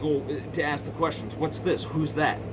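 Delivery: normal talking voice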